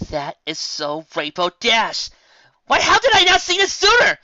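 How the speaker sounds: dawning delight; sudden realisation. Real, not acted. Listener realises the inspiration.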